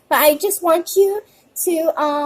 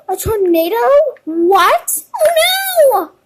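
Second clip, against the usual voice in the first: loud, high pretend shock; alarmed; 8 semitones higher, 7 dB louder than usual. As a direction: gasp and cry out at the danger. Storm warning, pretend alarm.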